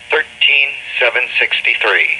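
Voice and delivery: ordinary talk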